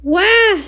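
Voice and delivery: startled yelp. Acted. Pony kicked by evil bunny.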